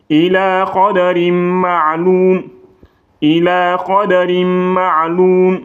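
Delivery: slow chanted recitation, the M of qadarim merged and sounded; Quranic recitation. Shown as a demonstration. Teacher chanting the verse for students, slow and melodic. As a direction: recite it slowly and melodically, as in tajweed class. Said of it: "mais il faut pas dire rin".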